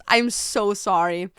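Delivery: usual speaking voice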